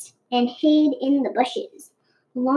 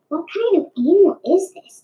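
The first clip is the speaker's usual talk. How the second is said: snorting dragon; 4 semitones higher, 3 dB louder, faster than usual. A narrator's reading. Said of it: "it snorted".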